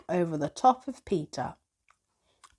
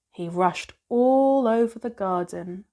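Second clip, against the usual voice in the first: ordinary delivery, nothing marked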